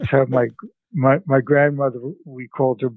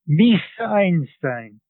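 put-on grandmother calling out slowly in a Central European accent, higher; strong Central European accent; 5 semitones higher, slower than usual. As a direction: call out in a thick Central European accent, drawn out. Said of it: "in a strong Central European accent".

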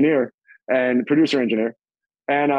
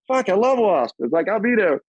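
much higher, gushing; thrilled fan; 6 semitones higher than usual. Retold or imitated. Hearing it's Wasp, high thrilled gush. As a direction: fan hearing the band name. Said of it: "I'm like".

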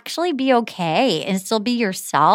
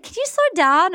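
a light imitation of the request at normal speed; quoting her request; 8 semitones higher than usual. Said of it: "and she was like".

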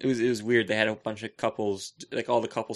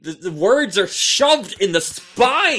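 comic rant; 7 semitones higher, 7 dB louder, slower than usual. Acted. Comic rant about tall books.

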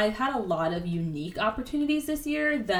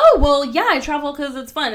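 higher, louder breezy mock voice; mocking most people; 6 semitones higher, 8 dB louder than usual. Host mimics people who travel for fun, mocking. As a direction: toss it off like a carefree tourist. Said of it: "most people are like".

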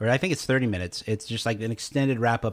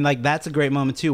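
usual speaking voice

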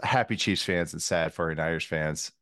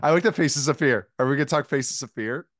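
higher, louder eager outburst; excited fan; 7 semitones higher, 5 dB louder than usual. Real, not acted.

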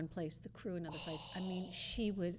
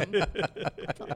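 ordinary talk, nothing marked

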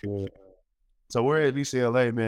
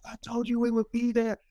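imitating crying coach; 14 semitones higher than usual. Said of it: "he's crying, man".

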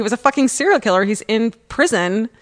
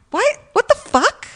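very high squeal of disbelief; shocked; 13 semitones higher than usual. Retold or imitated. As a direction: shriek it in stunned disbelief. Retold shocked reaction.

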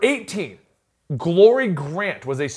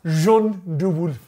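slow, careful French-accented Jean de Wolf; much slower than usual. Shown as a demonstration. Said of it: "he always adopts an accent".

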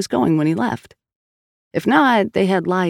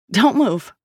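a touch of command, not truly shouted; firm order at half volume; mild narrated shout; 6 semitones higher than usual. A narrator's reading. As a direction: cop warning the woman, lightly voiced. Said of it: "Sal shouted".

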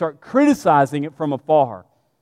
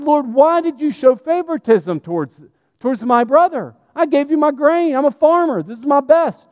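higher-pitched, indignant protest as Cain; acted anger, pleading his case in a raised pitch; mad; 8 semitones higher than usual. Cain protests to the Lord about favoritism, acting mad. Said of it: "He's mad".

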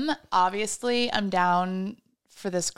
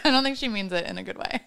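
laughing; 4 semitones higher, much faster than usual. Real, not acted. Laughing while reading post.

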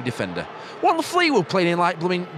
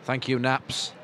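unimpressed; 4 semitones lower, 6 dB quieter, slower than usual. Real, not acted. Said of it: "I sound really unimpressed by all this".

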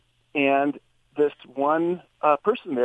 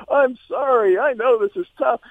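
higher cooing to a puppy; comforting the puppy; 10 semitones higher than usual. Retold or imitated. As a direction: soothe a little dog you just scolded. Comforting the puppy after scolding.

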